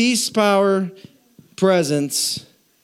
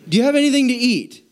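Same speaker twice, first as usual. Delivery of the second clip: exasperated Jesus; 8 semitones higher, faster than usual. Retold or imitated. Jesus trying to convince disciples.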